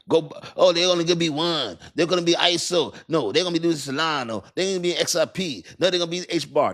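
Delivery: high, exaggerated voice of clueless chatterers; mimicking confused people. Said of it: "people be like".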